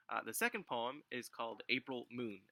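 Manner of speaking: normal talking voice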